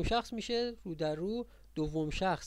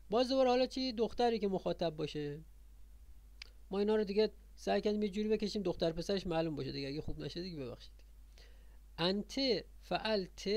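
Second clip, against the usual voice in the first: usual speaking voice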